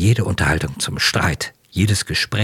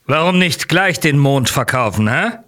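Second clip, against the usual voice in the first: brash mocking; 10 semitones higher, 5 dB louder than usual. A narrator's reading. Boisterous Nozdrev mocks Chichikov.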